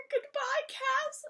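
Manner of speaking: teasing fan-meme take on the farewell; squeaky mock goodbye. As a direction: pitch it up high like a cute meme. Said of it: "Cass's thing is always, like, "Hello, Dean".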